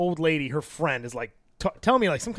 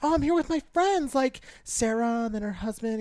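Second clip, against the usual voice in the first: high, soft put-on female voice; light, high voice for the other woman; imitated woman; 4 semitones higher, 7 dB quieter than usual. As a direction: imitate her meek reply, high and soft. Retold reply of the other woman.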